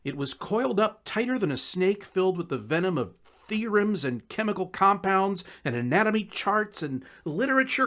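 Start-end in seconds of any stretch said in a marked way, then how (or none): none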